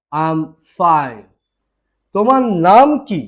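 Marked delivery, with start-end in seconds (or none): 0.1-1.3 very slow, each word drawn out; slow repeat. Shown as a demonstration. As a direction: spell it out slowly for the learner. (খুব ধীরে বলা)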